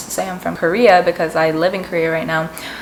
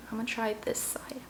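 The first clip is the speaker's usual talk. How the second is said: whisper; 3 semitones higher, 15 dB quieter than usual. Real, not acted. Quiet aside while eating on camera.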